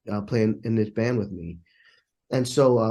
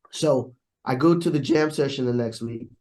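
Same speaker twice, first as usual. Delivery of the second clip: ordinary delivery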